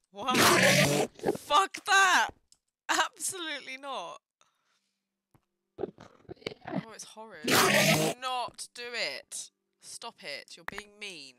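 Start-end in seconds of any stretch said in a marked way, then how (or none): 0.1-4.2 loud high panicked shout; panicked yelling. Real, not acted. 7.5-10.6 loud, slow warning; stern slow shout. Real, not acted. Shouting at approaching Enderman.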